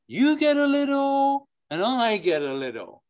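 high, lilting Yiddish sing-song; a Yiddish-accented lilt, rising high. Shown as a demonstration. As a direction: lilt it like an old Yiddish uncle. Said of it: "if you say it with a Yiddish accent".

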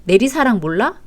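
usual speaking voice

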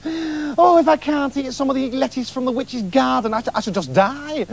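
high, wailing wife; high pleading wife voice. Acted. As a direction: whine it out like she's dying.

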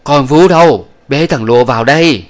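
loud, high call to the nurse; shouted call. Acted. Old woman calling the nurse from outside, loud and high. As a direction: yell out to the servant outside. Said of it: "bà gọi thét ra ngoài".

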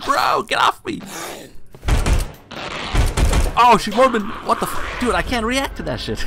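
high, loud, panicked outburst; real startled shouting. Real, not acted. Gamer attacked by a woman in the game, startled shouting. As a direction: shout as if jumped without warning.

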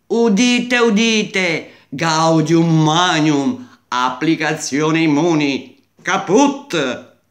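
loud, solemn public proclamation; town crier. Pretend. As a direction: announce it like a herald in the square. Proclama solenne della sconfitta. (banditore che proclama a gran voce)

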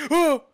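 loud high shriek of fright; startled scream. Real, not acted. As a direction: yelp at the jump scare. Jump scare hits.

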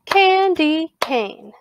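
"candy cane" slowly in three beats, starting high; syllable by syllable. Shown as a demonstration. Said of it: "Clap that with me now".

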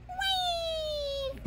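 very high, soft squeal; baby doll cry. Pretend. Voicing one crying baby doll, high soft fake wail. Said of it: "One baby go".

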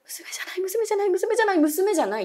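high, rising panicked denial; panicked repeating. Retold or imitated. Terrified roommate insisting it was not the girl, high panic. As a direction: blurt it over and over in terror.

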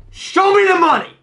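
high, excited call; hyped demand. Real, not acted. Player urging Toby for a good card, high hyped call. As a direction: call it out high, egging him on.

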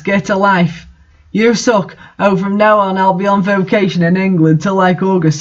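plain reading voice

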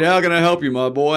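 Les Brown voice. Retold or imitated. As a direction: greet the young man like a proud elder. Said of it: "He said".

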